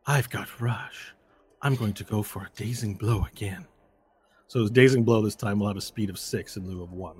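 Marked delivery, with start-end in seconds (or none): 0.1-3.7 hint of a foe announcing a plan; opponent's voice. Game master voices foe announcing next move, lightly in character. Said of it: "and he says".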